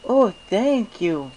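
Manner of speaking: very high, slow, dreamy filly voice; dazed Derpy. Acted. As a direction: say your name slowly as if half-lost. Derpy thanks Chaser.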